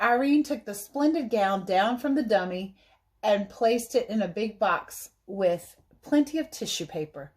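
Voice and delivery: plain reading voice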